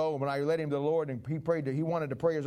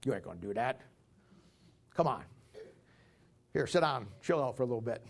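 calming Moses; 5 semitones lower, 4 dB quieter than usual. Acted. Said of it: "Moses says".

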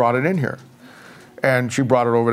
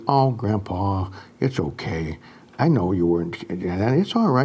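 put-on gentle child reply; 6 semitones lower, 4 dB quieter than usual. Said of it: "And she says".

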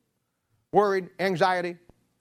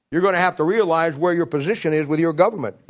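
louder, emphatic preaching; forceful preaching; 8 dB louder than usual. Real, not acted. Preacher warning the congregation about government, forceful. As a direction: press the point hard. Sermon climax, loud.

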